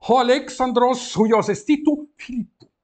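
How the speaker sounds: grego declamado, alto e agudo; leitura em voz alta. Shown as a demonstration. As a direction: anuncie a frase à turma. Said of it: "reads Greek aloud for class". (o professor declama o grego à turma, alto e agudo)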